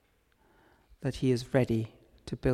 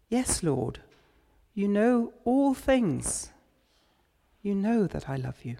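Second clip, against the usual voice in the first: slightly exasperated; 6 semitones higher than usual. Retold or imitated. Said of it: "probably answers slightly exasperatedly".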